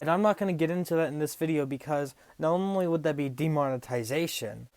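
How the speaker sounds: ordinary talk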